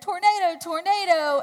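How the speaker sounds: high, loud imitation of a man's warning; mock holler. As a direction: call out the alarm inside a tearful retelling. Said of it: "this guy next to me said".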